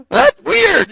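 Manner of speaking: loud high mock alarm; mock freaked out. Preacher acting out his own discomfort, mock alarm. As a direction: blurt it out half laughing. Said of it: "I'd be like".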